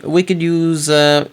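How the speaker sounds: ordinary talk, nothing marked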